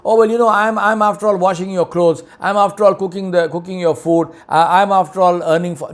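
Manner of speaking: high, mocking whine listing chores; mock excuses.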